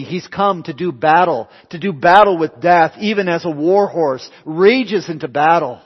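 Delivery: loud, high preaching that rises in intensity; full-force preaching. Real, not acted. Preacher telling of Jesus facing death at the tomb, full-force preaching. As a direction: preach it boldly, building to a ringing command.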